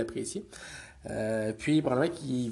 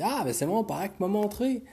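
put-on high, eager kid voice; eager little boy; 9 semitones higher than usual. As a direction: pipe up like a keen child. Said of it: "il me dit".

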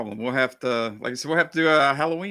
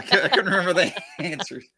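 higher, louder, laughing through the words; 8 semitones higher, 5 dB louder than usual. Real, not acted. [name] laughs at not remembering the quiz answers, laughing. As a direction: crack up while admitting it.

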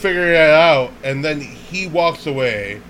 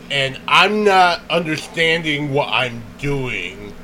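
usual speaking voice

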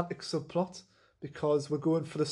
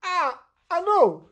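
put-on high, slow Italian-accented eureka; hammed-up Italian accent lighting up with an idea; drawn-out mock Italian realisation; 13 semitones higher, 5 dB louder, slower than usual. Acted Earl's realisation.

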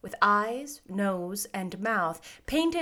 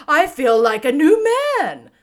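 loud, high, elated straw man; exultant scarecrow; 6 semitones higher, 11 dB louder than usual. A narrator's reading. Scarecrow just lifted off the pole, exultant. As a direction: proclaim it with relish.

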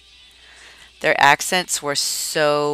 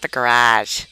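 Boston-accented "garage", punched louder than the proper one; 5 dB louder than usual. Shown as a demonstration. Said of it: "did not allow me to have an accent".

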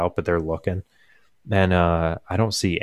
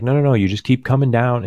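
quick, higher, louder imitation of a frustrated dad; mildly frustrated; 6 semitones higher, 5 dB louder, faster than usual. Dad guiding lost grandma by phone, imitated mild frustration. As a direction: act out dad's mounting impatience. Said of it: "he's getting a little frustrated".

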